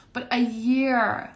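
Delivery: slow, lifted emphasis on the long wait; stressed "year". Real, not acted. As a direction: stretch the word year to make it land.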